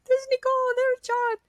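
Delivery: high, tearful cry of recognition. Retold or imitated. Retelling how she recognized her two friends, crying out. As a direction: re-enact crying out on spotting beloved friends. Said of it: "recognizing them and crying".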